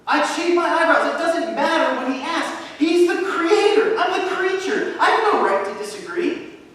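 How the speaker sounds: high, loud, fervent declaration; full-force preaching. Real, not acted. Pastor declares to his church he would obey at once, fervent shout. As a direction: preach it out with conviction.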